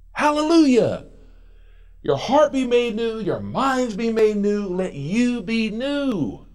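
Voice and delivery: high, ringing preacher's cry; full-force preaching. Acted. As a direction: lift the church with each phrase.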